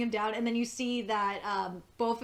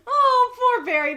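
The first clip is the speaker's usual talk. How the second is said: high, loud, laughing through the words; laughing pity; 11 semitones higher, 10 dB louder than usual. Real, not acted. Fan cracking up over Barry, laughing pity. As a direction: pity him while cracking up.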